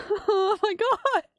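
high, slow, loud laughing shock; shocked exclamation. Real, not acted. Streamer sees the empty bird city, shocked and laughing. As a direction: burst out in laughing disbelief.

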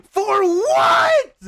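high, loud what; baffled shout. Real, not acted. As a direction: yell it in disbelief. Baffled by chat request.

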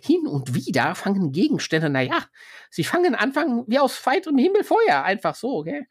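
reluctantly confessing the fires; broker voice. Acted.

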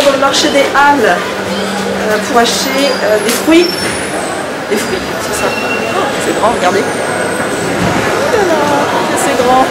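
muffled behind cloth mask; speaking with covered mouth; through a face mask. Real, not acted. Seen 0: Cloth face mask covers mouth and nose throughout selfie shots.

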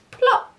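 short, punchy high-pitched plop; high loud plop. A narrator's reading. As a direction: pop it out like something landing. Sound effect of landing.

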